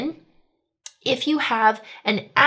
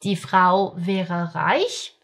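slow model sentence; much slower than usual. Shown as a demonstration. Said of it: "recap of the model sentences".

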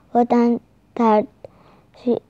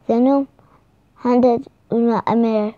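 plain reading voice

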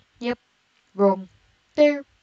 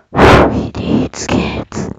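very low, slow and loud; creepy voice; 11 dB louder, much slower than usual. Pretend. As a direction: rumble it like a monster from below. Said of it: "that's my creepy voice".

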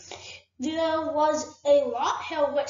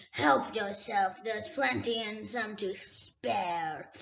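a great dragon toying with a thief; dragon Smaug; slower than usual. Acted. Smaug speaks to Bilbo.

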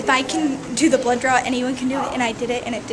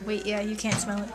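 ordinary talk

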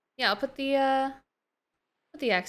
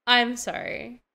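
usual speaking voice

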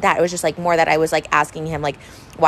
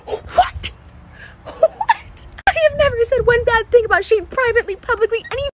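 sobbing disbelief; 13 semitones higher than usual. Real, not acted. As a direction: sob out the denial in shock. Hurt by friend's betrayal.

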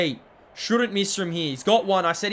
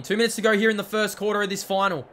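normal talking voice

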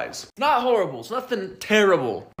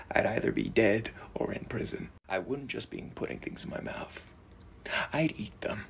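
whisper; 6 semitones lower, 11 dB quieter than usual. Acted. Whispered confession of intrusive thoughts.

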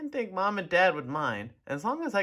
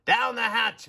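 loud pirate toast, a bit higher; captain's yell; 6 semitones higher, 4 dB louder than usual. A narrator's reading. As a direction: bang the table and yell. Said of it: "pounded his fist on the table and yelled".

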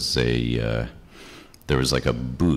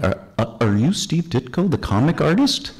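nervous high question; 11 semitones higher than usual. Retold or imitated.

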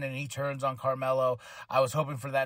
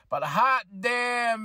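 pained exclamation; 6 semitones higher, much slower than usual. Real, not acted. Complaining about back pain.

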